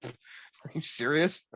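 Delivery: slow, stretched-out disbelief; hearing the name is still free, slow mock amazement. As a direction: drag out the surprise, half laughing. Nobody took the trademark.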